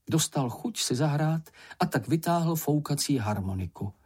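ordinary delivery

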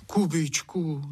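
high, drawn-out coaxing of the name; pleading. A narrator's reading. Wife begs Kuba to relent, high drawn-out plea.

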